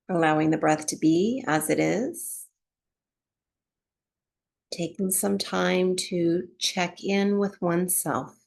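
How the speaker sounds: calm, unhurried meditation voice with long pauses; guided relaxation. Real, not acted. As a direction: ease them gently into breath awareness, phrase by phrase. Guides breath awareness and body relaxation.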